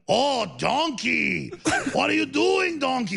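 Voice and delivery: put-on botched accent drifting toward Mexican, words stretched; Shrek. Host hamming up a Shrek quote, lilting Spanish-sounding accent. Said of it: "more like a Mexican accent or Spanish".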